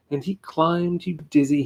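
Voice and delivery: plain reading voice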